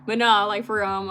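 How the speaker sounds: usual speaking voice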